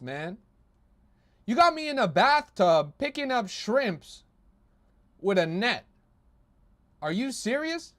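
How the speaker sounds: rising outrage, half laughing; incredulous. Real, not acted. Streamer shocked by memes.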